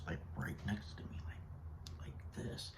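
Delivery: whispered, leaning in close; hushed whisper. Real, not acted. Whispering to viewers about the stranger creeping closer. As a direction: drop to a whisper as if she's still near.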